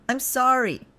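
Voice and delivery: high, loud choked apology; choking out an apology to her boyfriend. Acted. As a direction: force the apology out through a tight throat. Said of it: "I choked out".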